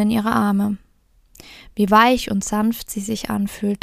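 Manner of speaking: gentle storyteller reading a Märchen; fairy tale narration. A narrator's reading. Reads a fairy tale aloud. (ruhig vorgelesenes Märchen)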